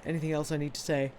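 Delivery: ordinary talk, nothing marked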